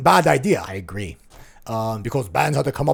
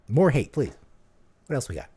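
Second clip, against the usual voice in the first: ordinary talk, nothing marked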